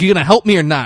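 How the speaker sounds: mock demand. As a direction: push him for an answer. Dad demanding lawyer help.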